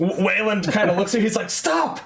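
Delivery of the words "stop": high, sudden cry to stop; alarmed order. Acted. Wayland to archer aiming past him, alarmed order. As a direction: call out a startled order.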